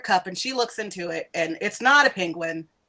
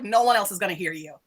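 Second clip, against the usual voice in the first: ordinary talk, nothing marked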